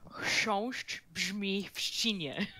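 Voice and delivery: slow careful "Chrząszcz brzmi w trzcinie", every consonant cluster spelled out; Polish tongue twister. Shown as a demonstration. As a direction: work through "Chrząszcz brzmi w trzcinie" slowly, syllable by syllable. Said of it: "tongue twister that I can't say correctly is".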